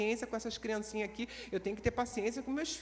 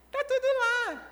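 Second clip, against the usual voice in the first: exclaiming high; 12 semitones higher, 5 dB louder than usual. Real, not acted. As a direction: shout it out, amazed at the mess. Repeated exclamation.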